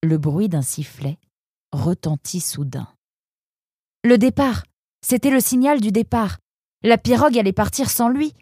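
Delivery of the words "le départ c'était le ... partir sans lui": alarmed narration. A narrator's reading. Narrator panics at whistle.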